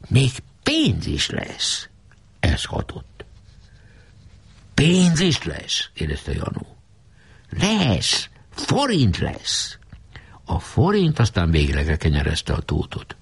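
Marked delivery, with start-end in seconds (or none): 0.1-1.9 high, slow, coaxing promise; tempting. A narrator's reading. Geréb baits the caretaker with money, slow and coaxing. 4.7-5.9 high, slow astonished ask; amazed question. A narrator's reading. Janó stunned by the promise of cash, high and slow. Janó greedy at money news. 7.5-9.8 high, very slow sly assurance; sly promise. A narrator's reading. Geréb seals the deal with Janó, high and slow.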